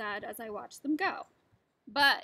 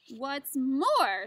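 loud, sharp retort; snapped; 8 dB louder than usual. Acted. Lorax scolding the Onceler, loud snap. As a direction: bite off the words. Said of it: "snapped the Lorax".

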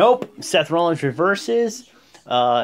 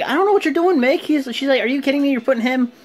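mock outraged Stephanie; 10 semitones higher, faster than usual. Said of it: "Stephanie's like".

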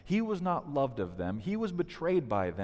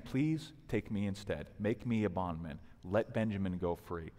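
quiet plea; 6 semitones lower, 4 dB quieter than usual. Retold or imitated. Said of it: "here's Judah's plea".

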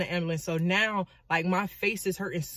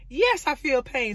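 slow, high, emphatic answer; exasperated mock insistence; acted exasperation; 7 semitones higher, 4 dB louder, slower than usual. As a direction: stretch it out, fed up and pitched up.

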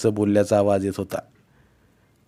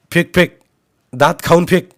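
loud, higher commands; shouted urging; 7 semitones higher, 8 dB louder than usual. A narrator's reading. Uncle pressing boy to throw, shouting. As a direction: urge the throw at full voice.

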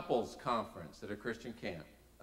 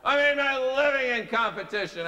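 mad; 9 semitones higher, 10 dB louder than usual. Retold or imitated. I was mad.

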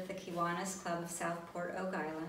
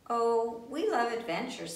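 eager; 5 semitones higher, 6 dB louder than usual. Acted. Bear's eager reply.

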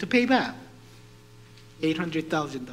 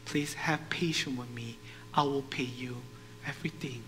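soft, low plea; subdued pleading; 3 semitones lower, 7 dB quieter than usual. Acted. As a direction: beg quietly, almost under your breath. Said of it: "pleading with this man".

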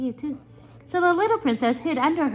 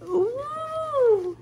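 very high, long wail; high-pitched drawn-out "ooh"; frightened ghost "ooh"; 12 semitones higher than usual. A narrator's reading. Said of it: "said the little ghost".

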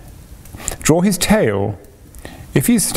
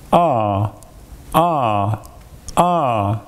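breathy ah noises; much slower than usual. A narrator's reading. Daddy makes the ah noise.

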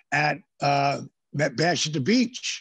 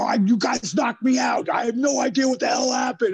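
defensive shout; 8 semitones higher than usual. Retold or imitated. Concussed wrestler shouting back at angry colleagues, defensive shout. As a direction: shout back at the whole room.

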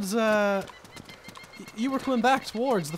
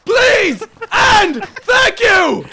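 slow, drawn-out sarcastic shout, much higher and louder; mock politeness; 9 semitones higher, 10 dB louder, much slower than usual. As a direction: fake courtesy bellowed after the kicked-out player.

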